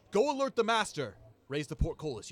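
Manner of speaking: loud, high call up to the tower; shouted order. Acted.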